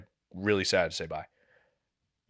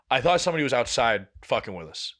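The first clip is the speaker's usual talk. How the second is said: normal talking voice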